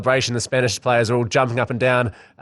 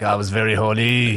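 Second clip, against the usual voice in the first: attempted Spanish accent; 3 semitones lower than usual. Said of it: "I can't do a Spanish accent".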